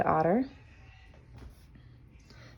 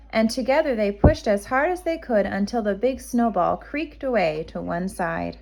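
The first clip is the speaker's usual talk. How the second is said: ordinary delivery, nothing marked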